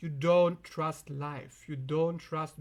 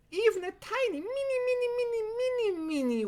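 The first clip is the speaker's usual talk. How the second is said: 1 tiny squeaky voice. Acted. Exaggerating how tiny a worry is.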